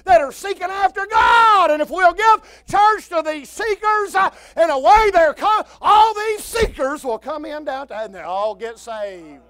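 loud, rising sermon holler; full-force preaching. Acted. Preacher thundering at his congregation, full-force holler. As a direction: preach it hard and high to the pews.

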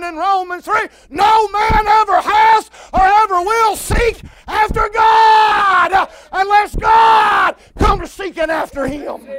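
loud, rising sermon holler; full-force preaching. Acted. Preacher thundering at his congregation, full-force holler. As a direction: preach it hard and high to the pews. Preacher declaring Romans three.

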